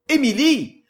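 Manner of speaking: loud, high repeated call; calling again. A narrator's reading. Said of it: "répéta-t-il".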